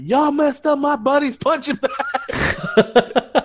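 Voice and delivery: high, loud, slow laughing yell; friend yelling. Retold or imitated. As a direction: imitate a buddy yelling at the crowd, cracking up. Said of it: "And he's like".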